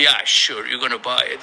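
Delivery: low, sarcastic yeah sure; sarcastic scoff. Retold or imitated. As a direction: drip with disbelief. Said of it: "it was like".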